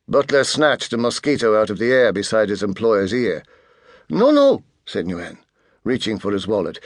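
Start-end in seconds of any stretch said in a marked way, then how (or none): 4.1-4.6 high, loud hurried no no; panicked protest. A narrator's reading. Nguyen protesting to Artemis, high alarmed cry. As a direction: blurt it out in alarm.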